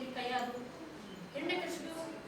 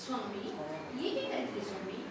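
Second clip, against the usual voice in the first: soft, lower-pitched queen answering her lord; queen's voice; 10 semitones lower, 11 dB quieter than usual. Acted. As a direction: answer your husband meekly as the queen. Queen answers the king's call. (ರಾಜನ ಕರೆಗೆ ಉತ್ತರಿಸುವ ರಾಣಿ, ಮೃದು ಧ್ವನಿ)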